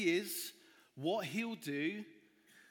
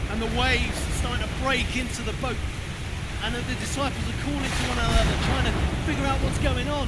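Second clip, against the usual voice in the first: loud and higher, yelling over the wind; shouting over the storm; 7 semitones higher, 13 dB louder than usual. Acted. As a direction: shout like you're caught in a gale. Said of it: "it's gonna get very loud".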